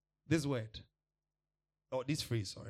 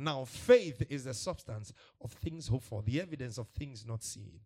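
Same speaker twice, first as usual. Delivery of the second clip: preacher reading scripture aloud; 6 dB louder than usual. Acted. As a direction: proclaim the verse with weight. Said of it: "Hebrews eleven one".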